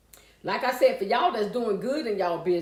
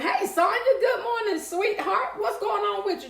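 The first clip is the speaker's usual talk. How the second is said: excited high greeting; 11 semitones higher than usual. Real, not acted. Greeting a viewer with laugh.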